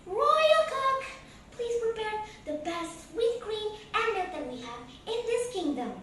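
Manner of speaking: calling the cook. A narrator's reading. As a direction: call out across the kitchen. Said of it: "said the princess".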